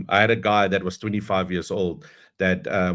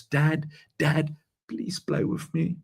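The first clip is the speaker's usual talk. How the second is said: hushed, slow whiny plea, breathy and drawn out; a little boy begging his busy dad; whiny child plea; 6 semitones higher, 4 dB quieter, slower than usual. Retold or imitated. Storyteller voices the bored boy begging his dad, hushed and slow. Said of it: "And there he cries".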